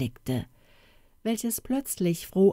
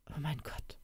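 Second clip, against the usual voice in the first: hushed, much softer, breaking off; stammered; 2 semitones lower, 14 dB quieter than usual. A narrator's reading. Father overwhelmed, stammering at the thought. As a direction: let it slip out barely audible. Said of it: "stammelte er".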